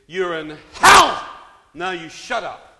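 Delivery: slow, loud roaring shout; demonic shout. Retold or imitated. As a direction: roar it as the ruler of hell. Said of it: "he said".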